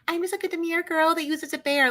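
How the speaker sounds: high, sweet mock girly voice. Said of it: "you know, like".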